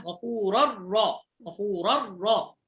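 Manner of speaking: غفورا را stretched with a doubled r; slowed idgham. Shown as a demonstration. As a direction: drag غفورا را so the tanwin becomes a doubled r. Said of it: "بدل التنوين نطقنا راء".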